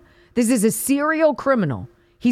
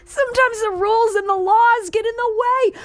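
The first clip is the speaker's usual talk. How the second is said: high, laughing repeat of his words; laughing disbelief; 12 semitones higher than usual. Real, not acted. Host repeating the caller through laughter in disbelief. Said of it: "I'm laughing 'cause if I wasn't laughing".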